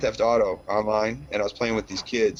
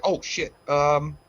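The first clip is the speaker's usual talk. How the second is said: high, louder sheepish oops; retold caught out; 6 semitones higher, 3 dB louder, much slower than usual. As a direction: realise you were overheard swearing. Dad caught swearing by daughter.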